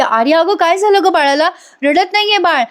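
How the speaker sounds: high-pitched worried appeal; retold alarm. Woman asks helper on phone about silent baby, alarmed. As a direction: ask for help with rising pitch.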